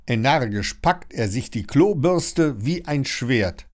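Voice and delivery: ordinary delivery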